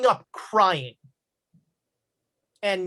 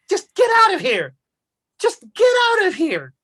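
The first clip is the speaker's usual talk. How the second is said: much higher, loud shriek of get out; mother yelling; 11 semitones higher, 7 dB louder than usual. Acted. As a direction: scream him out of the house. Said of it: "kicking you out of your house".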